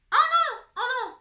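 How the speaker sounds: high, loud "Oh non!" at a slip-up; sudden loud oh no of dismay; dismayed cry. Real, not acted. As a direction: yelp at your own mistake. Mistake while writing.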